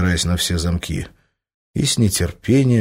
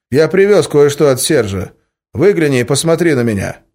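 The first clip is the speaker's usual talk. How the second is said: brisk, louder male voice on the line; a terse courier calling up; male caller voice; 6 semitones higher, 6 dB louder than usual. A narrator's reading. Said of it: "раздался в ответ мужской голос".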